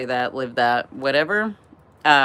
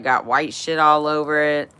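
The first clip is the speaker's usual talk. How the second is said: ordinary talk, nothing marked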